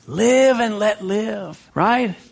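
slow, sing-song mock of worldly advice; mock sweet worldly slogan, slow and high; mocking the world's lie. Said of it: "Life is so much more fun and fulfilling if you do it however you want to".

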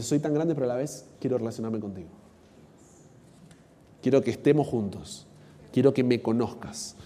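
soft, low words of closeness; gentle, quiet divine invitation; tender, intimate God. Acted. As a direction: draw the listener close, hushed and low. Voices God wanting closeness with us.

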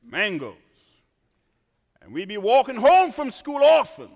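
ordinary talk

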